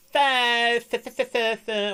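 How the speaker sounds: put-on high, lisping baby voice stuck on th. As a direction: stutter th like a lisping toddler. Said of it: "I'd be like".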